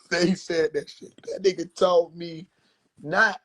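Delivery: usual speaking voice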